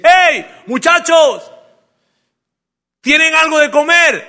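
high, loud call across the water; grito de lejos. Acted. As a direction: shout to people far off, drawn out. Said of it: "les pega un grito y les dice". (grito fuerte llamando desde lejos)

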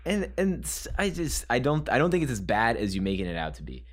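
ordinary delivery

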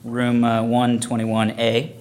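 loud, low announcer voice; host a con panel, booming; panel moderator voice. Acted.

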